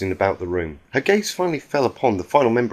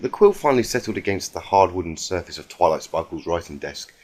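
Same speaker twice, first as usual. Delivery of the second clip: ordinary delivery, nothing marked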